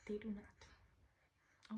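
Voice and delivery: slow, hushed, firm whisper; a slow, firm whisper to make the point; emphatic whisper. Real, not acted.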